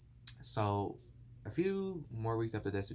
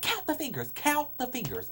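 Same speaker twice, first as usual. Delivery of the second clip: much higher, louder, insistent teacher; frustrated teacher; 5 dB louder than usual. Retold or imitated. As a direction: repeat it like you're at your wits' end. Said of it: "she got so frustrated with me".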